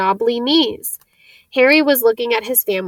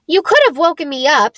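higher and louder, a cross grumble at Harry; cross complaint; 5 semitones higher, 5 dB louder than usual. A narrator's reading. Ron to Harry, sulking at being left behind, cross and louder. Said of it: "said Ron crossly".

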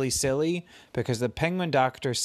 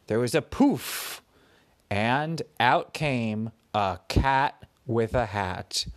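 ordinary delivery

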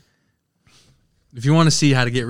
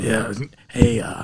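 lightly raspy smoker; a hint of a rasp, low-key; smoker's voice; 4 semitones lower, 6 dB quieter, slower than usual. Woman in old anti-smoking ad, lightly raspy smoker. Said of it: "a smoker's girl voice".

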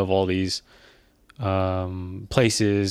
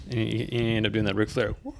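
usual speaking voice

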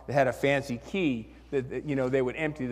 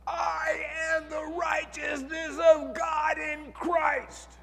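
high, slow, full-throated shout; declaring it at full force in a noisy car; screaming at the top of lungs; 13 semitones higher, slower than usual. Retold or imitated. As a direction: cry it out like a proclamation to the sky. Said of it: "I was screaming practically at the top of my lungs".

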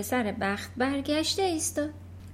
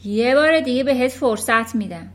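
cold loud warning; 8 dB louder than usual. Acted. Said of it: "بدون اون که حرکتی بکنه گفت".

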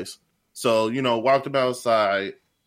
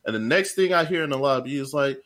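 ordinary delivery

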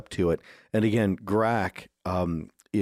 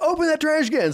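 high, strained sergeant command; mock sergeant bellow, pitched way up; chewing out; 4 dB louder than usual. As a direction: imitate a drill sergeant ordering a recruit about. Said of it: "chewing him out".

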